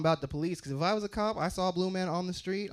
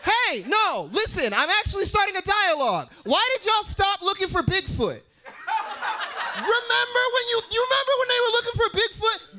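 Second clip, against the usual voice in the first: much higher, louder call; 13 semitones higher, 4 dB louder than usual. Real, not acted. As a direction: hail the crowd loudly.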